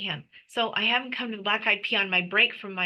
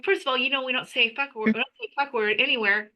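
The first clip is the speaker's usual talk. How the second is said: ordinary delivery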